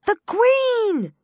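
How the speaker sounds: loud, high warning cry; a gardener crying out in alarm; calling out. Acted. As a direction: call out the alarm to the others. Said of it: "one of them called out".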